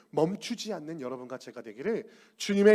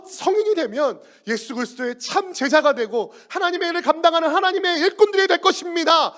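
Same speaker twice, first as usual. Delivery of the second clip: loud, high, desperate pleading to Jesus; pleading cry; 10 semitones higher, 9 dB louder than usual. Acted. Parents crying out to Jesus, loud and pleading. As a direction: beg him loudly for the children.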